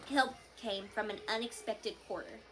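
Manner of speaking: plain reading voice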